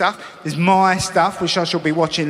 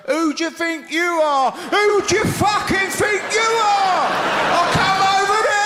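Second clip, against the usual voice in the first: high, roaring mock fight shout; drunken pub challenge; 14 semitones higher than usual. Comic acting a drunk in a boozer, furious high shout. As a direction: bawl it like a drunk squaring up at closing time.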